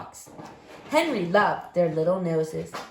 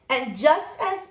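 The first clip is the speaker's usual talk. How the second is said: plain reading voice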